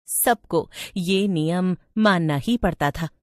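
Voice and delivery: usual speaking voice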